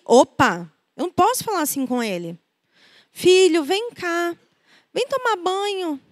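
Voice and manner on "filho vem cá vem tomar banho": lovingly. Retold or imitated. Mother calls her son to bath and dinner, loving and gentle. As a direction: coax him gently, with love. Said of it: "tudo com amor".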